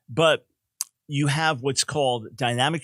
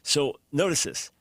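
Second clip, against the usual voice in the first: normal talking voice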